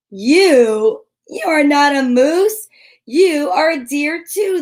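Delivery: laughing deer. A narrator's reading. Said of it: "the deer laughed".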